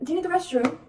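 high, put-on British accent. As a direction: play it off in a fake British accent. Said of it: "I had to play it off".

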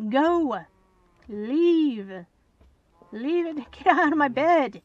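loud exasperated yelling; shouting. Real, not acted. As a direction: scream at the cows. Screaming at cows in house.

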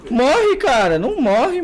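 high, exasperated gamer shout; frustrated yell. Real, not acted. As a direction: yell at an opponent who won't go down. Frustrated that opponent will not die.

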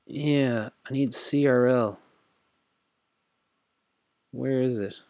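usual speaking voice

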